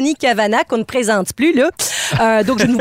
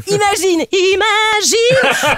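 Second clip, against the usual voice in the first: drawn-out imagine; 7 semitones higher, much slower than usual. Acted. Stretched imagine.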